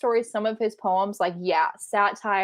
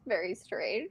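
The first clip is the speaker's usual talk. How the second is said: whispered; 10 dB quieter than usual. Real, not acted. Whispered aside about spider.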